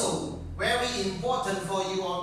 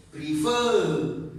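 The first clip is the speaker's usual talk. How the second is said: "prefer" with a strong final "fer"; stress on second syllable; 3 dB louder than usual. Shown as a demonstration. Teacher repeating the word to show the stronger part, "prefer" stressed on the end. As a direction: make "fer" outweigh "pre" in "prefer". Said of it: "fur is stronger than pre".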